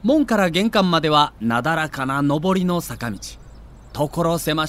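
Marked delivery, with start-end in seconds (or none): none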